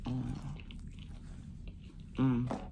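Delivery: humming while eating; chewing chicken. Real, not acted. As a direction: mouth full of food. Seen 3: Bites chicken piece at 0.1–0.8, then chews with closed mouth.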